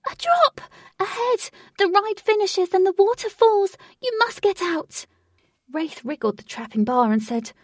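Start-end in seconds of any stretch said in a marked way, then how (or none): 0.1-5.0 high, loud and urgent; screamed warning. A narrator's reading. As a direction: shriek a desperate warning. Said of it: "She screamed".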